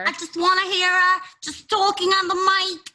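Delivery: put-on high, playful Bronx Puerto Rican accent; Puerto Rican Bronx impression. Joking about hearing her on the mic, Bronx accent impression. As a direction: put on a Rosie Perez-style Bronx voice. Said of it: "That was a pretty good impression".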